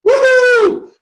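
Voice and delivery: high loud woohoo; sudden high cheer; excited whoop. Pretend. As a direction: cheer yourself on. Hyping up before calls.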